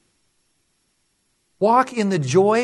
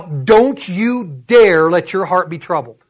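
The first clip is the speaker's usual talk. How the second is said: "don't you dare" slow and punched; stern emphatic warning; 3 semitones lower, 4 dB louder, slower than usual. Acted. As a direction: lean hard on each word of "don't you dare". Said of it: "can you hear what he's saying".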